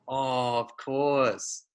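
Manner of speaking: normal talking voice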